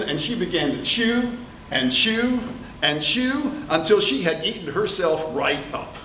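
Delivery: plain reading voice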